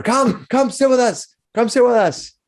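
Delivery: high, slow, jolly invitation; boisterous miner welcome; friendly miners calling over. Acted. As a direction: beckon him to the table. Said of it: "calling you over".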